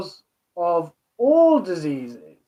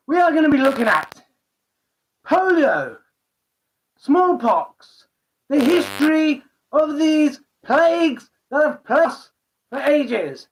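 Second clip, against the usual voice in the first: high, silly put-on presenter voice; stupid voice; 5 semitones higher than usual. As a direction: ham up a goofy host voice. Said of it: "get rid of the stupid voice now".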